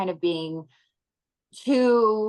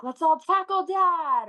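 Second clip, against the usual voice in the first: high, slow, sing-song invitation; mock excited family pitch; playful kid-like suggestion; 11 semitones higher, 4 dB louder than usual. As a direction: pitch it up like rallying the kids to play. Mom voicing playful family suggestions.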